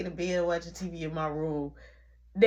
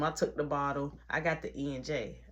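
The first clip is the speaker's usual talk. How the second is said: normal talking voice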